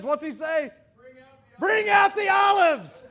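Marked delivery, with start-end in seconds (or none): 1.6-3.0 slow, loud, higher booming host voice; hammed-up announcer calling for the jar; mock talk show host command. Preacher imitates talk show host calling out the olives, hammed-up loud. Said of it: "show says".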